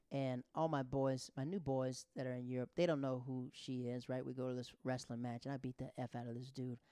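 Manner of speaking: ordinary talk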